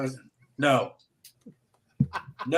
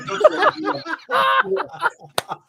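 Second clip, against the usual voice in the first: very high, loud, drawn-out mock scolding; exaggerated high-pitched telling-off, half laughing; imitating Janet; 5 dB louder, much slower than usual. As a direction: do her scolding voice way up high, slowly. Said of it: "with this voice that she makes".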